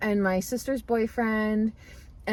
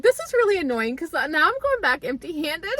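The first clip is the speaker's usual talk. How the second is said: higher-pitched, amused mock annoyance; kinda mad; 6 semitones higher than usual. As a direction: retell your irritation, half laughing. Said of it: "I was kinda mad".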